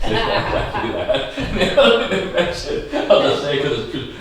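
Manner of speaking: high, laughing talk. Real, not acted. Host laughing as he retells the visitors' remarks, high. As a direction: chuckle through the telling.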